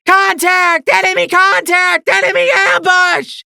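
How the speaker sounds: very high, loud hysterical shrieks; hysterical scream. A narrator's reading. Mare warning of the ambush, hysterical screaming. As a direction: shriek the alarm in panic. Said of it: "the mare screamed hysterically".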